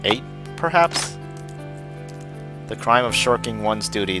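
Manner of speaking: normal talking voice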